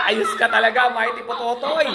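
words spilling out through a real laugh. Real, not acted. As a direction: laugh while you say it, amused by the answer. Laughing at the viewer's answer.

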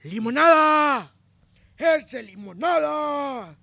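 high, slow drawn-out yell of the nickname; long mocking shout. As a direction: stretch the name out across the plaza. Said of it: "la misma persona sentada en la banca". (grito burlón y alargado)

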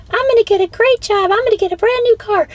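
high, falsetto, fast toy-positive voice; mock positive thinker. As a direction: squeak it like a naive optimist. Mocking naive positive thinking.

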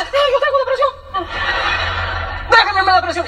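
very high, drawn-out hammed-up whine; comic tantrum, refusing to be calmed; mock wailing. Mimicking a sufferer told to calm down, mock wail. Acts out possessive depressed person.

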